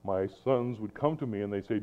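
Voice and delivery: ordinary talk, nothing marked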